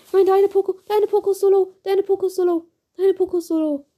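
urgent pleading. Real, not acted.

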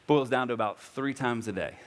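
normal talking voice

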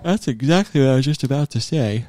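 talking through a yawn. Real, not acted. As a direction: yawn while speaking. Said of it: "Sorry for yawning".

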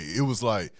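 normal talking voice

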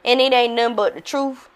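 ordinary talk, nothing marked

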